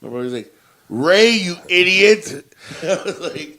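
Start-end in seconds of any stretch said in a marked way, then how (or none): 0.9-2.6 loud, drawn-out scolding; loud exasperated correction; exasperated brother. Retold or imitated. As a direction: set him straight, loudly and slowly. Brother snapping at him in retelling.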